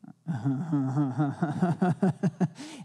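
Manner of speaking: big far-off belly laugh; his joyful laugh. Retold or imitated. Pastor imitating Chuck Perry's joyful laugh in the tunnel. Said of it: "and he's like".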